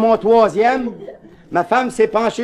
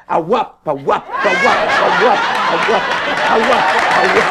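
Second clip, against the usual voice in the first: helicopter noise. Retold or imitated. Imitating helicopter all evening.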